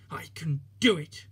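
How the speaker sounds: pushed out loud with effort. Acted. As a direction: puff it hard as the climb peaks. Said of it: "he panted".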